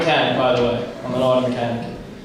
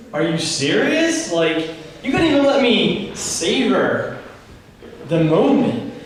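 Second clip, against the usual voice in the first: put-on indignant teen; 5 semitones higher, slower than usual. Teen protesting to dad.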